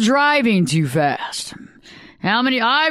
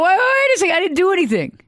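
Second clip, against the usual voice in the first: defensive protest; 10 semitones higher than usual. Retold or imitated. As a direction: protest your innocence, pitch shooting up. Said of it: "You go".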